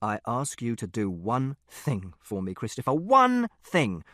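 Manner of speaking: slow, deliberate "one thing", each word set apart; slow, angry reproach. A narrator's reading. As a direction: weigh down "one thing", building on the repeat. Father reproaches son slowly.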